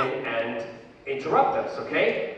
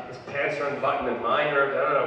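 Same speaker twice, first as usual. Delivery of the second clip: ordinary talk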